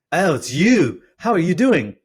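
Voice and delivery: loud, high posh British greeting; hammed-up upper-class British. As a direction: hail someone as a hearty British chap. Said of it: "accent that I had been assured was very upper class Brit".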